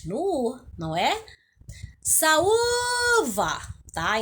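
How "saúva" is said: high, drawn-out call of Saúva with a long held Ú. Shown as a demonstration. Teacher calls the word at a distant bus stop, high drawn-out call. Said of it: "onde você demora mais chamando".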